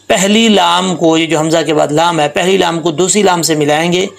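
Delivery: ordinary talk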